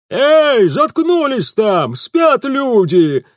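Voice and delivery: high, loud, drawn-out bawl; angry yell. Acted. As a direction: shout from inside a house at brawlers. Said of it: "проорали из домишки". (раздражённый ор из дома)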